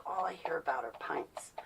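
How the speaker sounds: whispered mock complaint; a mocking whispered grumble. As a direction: hiss it under your breath like a fed-up friend. Friends complaining, mocked.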